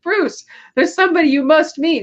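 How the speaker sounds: lightly voiced high society lady. Party lady leading Bruce to a guest, high mock voice.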